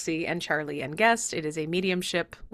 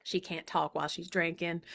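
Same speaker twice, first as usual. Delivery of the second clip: softly voiced puppet aside; Proxy; 2 semitones lower, 9 dB quieter than usual. Puppet covers while the host drinks, softer accented voice. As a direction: hand puppet accent, quiet. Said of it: "Proxy has her own voice".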